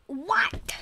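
breathy whispered question. Real, not acted. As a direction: whisper it, puzzled. Confused at game.

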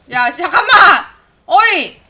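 loud alarmed yell; shouting wait at the team. Real, not acted. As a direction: call out to stop them. Streamer shouts at teammates.

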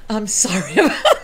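breaking into a real laugh; laughing apology. Real, not acted. Host apologizing to viewers for dog interruption, laughing.

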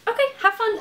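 awkward sign-off. Real, not acted. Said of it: "that was so awkward".

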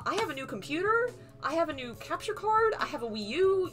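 ordinary delivery

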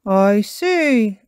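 a hint of a father figure taking it in; Daddy Pig, knowing. A narrator's reading. Daddy Pig reacts knowingly to scene.

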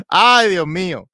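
slow, loud laughing sigh; breathless after laughing. Real, not acted. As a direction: let it out still laughing. Recovering from laughter.

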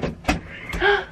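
sharp breathy gasp of surprise; surprised gasp. Real, not acted. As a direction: gasp on seeing a friend. Spots friend walking in.

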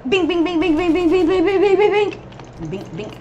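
high, chanted string of bings; rapid-fire sound imitation. Gamer voicing the machine-gun attack, high repeated bings.

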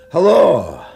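loud phone hello. Acted. Old man shouts hello into the phone. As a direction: shout into the receiver.

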